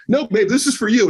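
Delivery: jokingly. Retold or imitated. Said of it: "kinda, like, jokingly".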